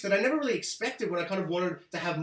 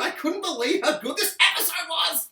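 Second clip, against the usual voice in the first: gushing delight; 9 semitones higher than usual. Real, not acted. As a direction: swoon over "good" up high. Gushing continues.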